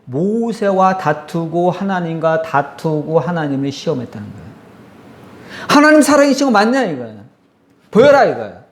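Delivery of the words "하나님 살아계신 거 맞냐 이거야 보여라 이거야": much higher, louder, taunting rise; defiant challenge. Retold or imitated. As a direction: hurl a taunt at God. People defying God.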